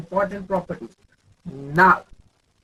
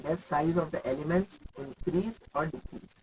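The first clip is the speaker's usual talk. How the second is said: softer repeat; 5 semitones lower, 8 dB quieter than usual. Shown as a demonstration. Teacher re-asking the class, softly. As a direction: question repeated much softer, almost to herself. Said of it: "repeats question".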